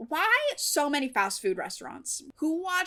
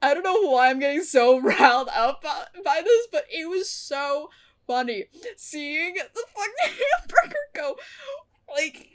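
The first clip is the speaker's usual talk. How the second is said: talking through bursts of laughter; can barely get the words out for laughing; 5 semitones higher, 6 dB louder than usual. Real, not acted. Laughing recalling the burger.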